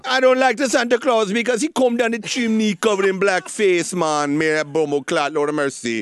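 lightly voiced Jamaican postal worker; a hint of a Jamaican patois; mock Jamaican accent. Answers a cohost's question by voicing a Jamaican postal worker in a light Jamaican accent. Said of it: "How about if it's a Jamaican postal worker?".

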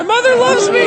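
high, mocking parrot of the line; mocking imitation. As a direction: throw it back high and mocking. Host mocks the comedian's line.

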